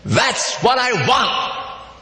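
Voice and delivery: a high, slow, insistent yes; mild mock shout. Customer insisting to the waiter, high and emphatic. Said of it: "And the man shouts and he says".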